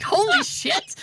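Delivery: high excited exclaim. Retold or imitated. Telling a friend how thrilled she was, squealing high. Disbelief at being rated ten.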